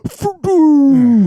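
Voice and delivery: loud high-pitched whoosh-boom; imitated lance impact. Showing a stick hitting the chest, explosive sound effect. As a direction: burst out a big impact noise.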